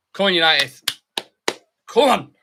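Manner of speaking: loud rising cheer for United; shouting encouragement. Real, not acted. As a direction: roar them on from the sofa. Cheering on his team at kickoff.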